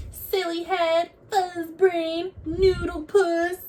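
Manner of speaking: put-on high, childish insult taunts; name-calling. A narrator's reading. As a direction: tease like a squabbling cub. Cubs trading insults.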